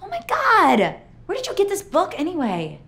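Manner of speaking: loud, high outburst; shocked shout. Acted. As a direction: cry out in shock. Finds the scrapbook gone.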